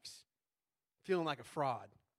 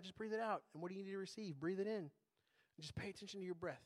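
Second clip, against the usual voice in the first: softer, calm meditation voice; guided breathing; 4 dB quieter than usual. Acted. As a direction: guide them gently through each breath. Leads a breathing exercise.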